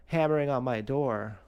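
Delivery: ordinary delivery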